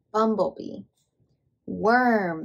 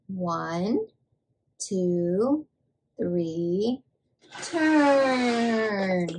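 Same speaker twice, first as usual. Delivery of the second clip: ONE, TWO, THREE separately, then a long drawn-out TURN; slow count-along; 2 semitones lower, much slower than usual. Shown as a demonstration. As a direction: count slowly for a child to join, stretching TURN. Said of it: "Let's say it".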